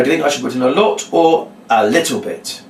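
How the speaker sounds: emphasised "little bit" as the last choice; stress on LITTLE BIT. Shown as a demonstration. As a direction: steer the answer by punching "little bit". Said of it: "you emphasise the word little bit".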